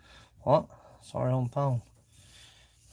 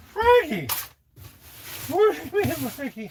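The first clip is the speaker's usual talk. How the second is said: high, loud calling voice; 12 semitones higher, 4 dB louder than usual. Pretend. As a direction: shout up high across the shop for Frankie. Calls out to figure.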